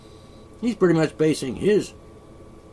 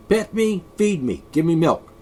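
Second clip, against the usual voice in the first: demanding cat voice; 3 semitones higher, 5 dB louder than usual. Retold or imitated. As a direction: voice the needy cat asking for everything. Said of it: "Cat says".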